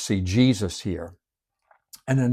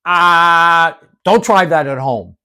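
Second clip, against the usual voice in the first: mock warning; 5 semitones higher, 7 dB louder, slower than usual.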